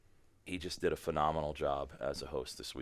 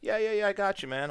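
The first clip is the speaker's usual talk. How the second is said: high, louder breezy assurance; mock over-eager friend; eager buddy; 4 dB louder than usual. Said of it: "He's like".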